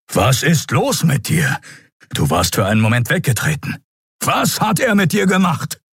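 gruff, urgent demand, pitch climbing; blustering Keru. Acted. Keru demands what was done to Endriel, blustering. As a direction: bluster like a big bass-voiced brute. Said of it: "polterte hinter ihr Kerus Bassstimme".

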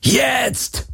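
viel höher, gebrüllter Befehl; brüll das Signal zur Flucht; Brüllen. A narrator's reading. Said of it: "brüllte Garlin". (Garlin brüllt Kirai das Fluchtsignal zu)

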